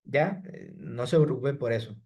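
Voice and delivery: ordinary talk, nothing marked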